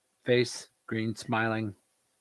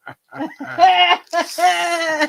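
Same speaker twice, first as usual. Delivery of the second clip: high, far evil cackle; a gleeful villain's cackle; 8 dB louder than usual. Pretend. Voicing snickering villain friend.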